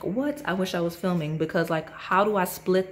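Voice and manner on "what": shocked what. Retold or imitated. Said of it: "I was like".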